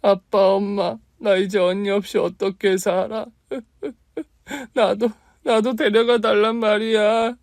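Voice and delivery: high, loud crying wail; sobbing. Acted. Aunt wails to her parents' photos, high and sobbing. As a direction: break down begging through sobs. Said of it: "소리내서 울고 있는데".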